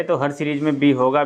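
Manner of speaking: normal talking voice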